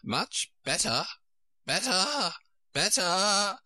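squeakier with each repeat; high-pitched, climbing Queen voice; rising to squeak. A narrator's reading. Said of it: "her voice rising to a squeak".

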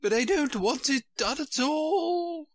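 high, put-upon groan of a fussy queen; a mild high-pitched royal moan. Acted. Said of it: "groaned the poor Queen".

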